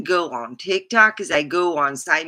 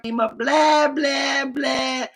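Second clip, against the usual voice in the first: mocking blather; 8 semitones higher, slower than usual. Mocking Mouthy Mike's chatter.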